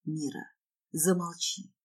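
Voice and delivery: low, hushed plea for silence; soft, urgent man's murmur; whispered. Acted. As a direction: hush her softly and low. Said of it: "шепчет он".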